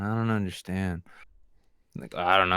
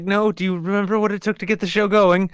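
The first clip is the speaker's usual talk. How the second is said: Poe voice; 10 semitones higher, 4 dB louder, faster than usual. Pretend. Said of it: "It's like".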